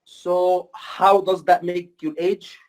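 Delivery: very slow, careful question; doctor slowly asking a patient his age; slow deliberate question. Shown as a demonstration. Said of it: "it has to be slow".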